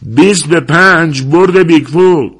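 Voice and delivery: loud, slow, high call across the crowd; waving cash and hollering the odds; shouting a bet. A narrator's reading. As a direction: yell out the wager over the noise.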